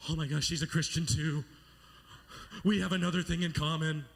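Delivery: loud, giddy outburst; mock elation. Boy finding his crush shares his faith, mock elation. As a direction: burst out with boyish delight. Smitten boy thrilled by news.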